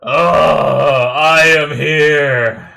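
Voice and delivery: tearful, loud and very slow through sobs; choked up, real tears; crying. Real, not acted. Fan overcome while the hero arrives, crying loudly. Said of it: "I'm gonna cry".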